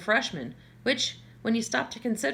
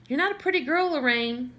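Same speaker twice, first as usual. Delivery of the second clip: higher, louder mother voice, blunt; mother's put-down; 6 semitones higher, 3 dB louder than usual. A narrator's reading. Mother bluntly telling Lorraine she isn't pretty, higher and louder. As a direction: say it like a mother cutting her daughter down. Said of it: "she has been nice enough to inform me".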